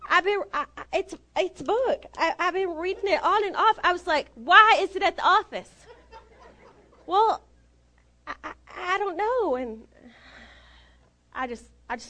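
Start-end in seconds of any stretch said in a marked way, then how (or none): 0.1-3.8 higher-pitched, stammering excuse; flustered coworker. Retold or imitated. Flustered coworker questioned about book. 4.4-5.7 high, loud mock interrogation; retold indignation. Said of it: "it made me mad". 7.1-9.6 high-pitched stammer; flustered coworker. Retold or imitated. Flustered coworker answers accusation.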